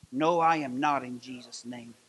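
ordinary talk

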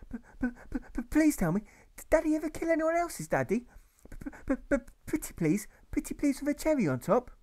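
put-on high child voice, stuttering on but and did; a frightened boy begging for an answer; stammering boy. Boy pleading with his mother about his father, stammering.

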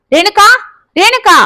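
a father shouting for his wife; shouting a name. A narrator's reading. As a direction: yell her name across the house. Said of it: "என்று கத்தினார்".

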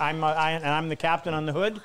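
ordinary talk

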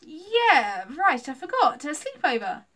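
loud, high, overdone agreement; exaggerated fake realisation; playing along. A narrator's reading. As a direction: pretend to remember, too eagerly. Lucy plays along with the fib.

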